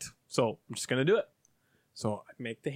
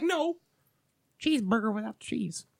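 high mock correction; old lady customer; 6 semitones higher than usual. Old lady correcting the cashier, high put-on voice. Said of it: "This old lady comes up".

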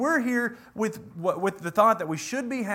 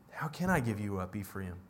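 much lower and softer, grieving; mournful lament; 10 semitones lower, 8 dB quieter than usual. Acted. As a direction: let the question sink into quiet sorrow. God's lament in scripture.

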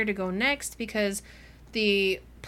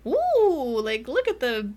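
playfully wowed; mock wonder; 3 semitones higher, 4 dB louder than usual. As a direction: imitate someone dazzled by a new toy. Voicing Winter marvelling at machines.

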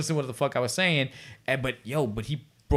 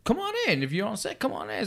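high, softer impression of a welcoming star; Bill Cosby; 7 semitones higher, 4 dB quieter than usual. Star inviting lost extra into trailer, imitated Cosby voice. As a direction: lilt it up high, mock-inviting. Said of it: "then he was like".

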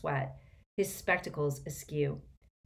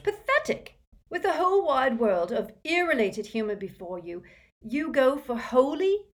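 high, louder mock outrage; exasperated scorn; 8 semitones higher, 5 dB louder than usual. A narrator's reading. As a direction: berate your brother for his poor joke. Brother mocking George's bad joke.

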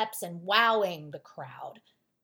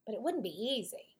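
ordinary delivery, nothing marked

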